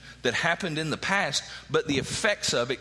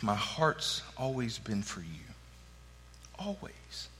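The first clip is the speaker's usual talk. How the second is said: tender; 6 semitones lower, 9 dB quieter than usual. Acted. God's tender words.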